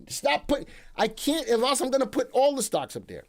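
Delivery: mock exasperated. Scolding himself playfully.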